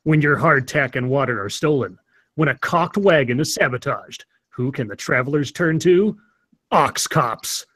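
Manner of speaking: dramatic period-ad narrator; deadpan Old West cop-show announcer; mock ad read. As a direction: read it like a solemn frontier trailer, in measured phrases.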